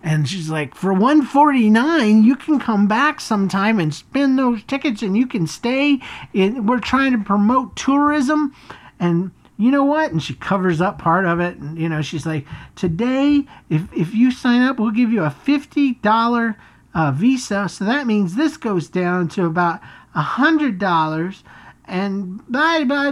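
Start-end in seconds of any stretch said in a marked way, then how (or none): 0.7-8.6 high, pushy sales pitch; a mock evil salesman making the pitch; hammed-up sales lady voice. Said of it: "she transforms into an evil salesman". 12.8-23.1 high, pushy sales pitch; a mock evil salesman making the pitch; hammed-up sales lady voice. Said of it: "she transforms into an evil salesman".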